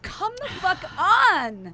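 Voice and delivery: slow, loud disbelief; disbelieving. Real, not acted. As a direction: drag out the disbelief. Disbelief at story.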